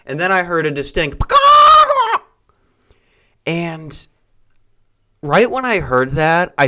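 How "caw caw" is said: high, loud squawking caw; chicken squawk. Retold or imitated. Caller imitating the distinct chicken sound on the call, loud high squawk.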